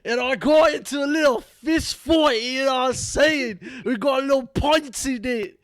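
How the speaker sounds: loud, high, slow mock Irish shouting; over-the-top Irish accent, yelled high. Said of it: "I can't do a, um, a s-, uh, Irish accent".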